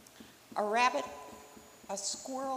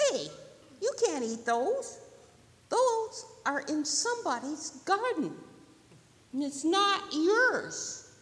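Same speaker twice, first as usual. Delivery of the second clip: higher, emphatic turtle voice; scolding turtle; 8 semitones higher, 8 dB louder than usual. Acted. Turtle stopping rabbit eating garden leaves, scolding. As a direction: tell off firmly, slowing at the end.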